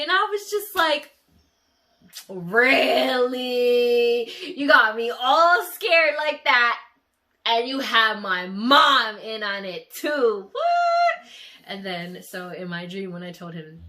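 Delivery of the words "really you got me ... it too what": very loud, high, slow indignant yelling breaking near tears; furious at being pranked; outraged shout. Retold or imitated. Tricked by boyfriend and mom.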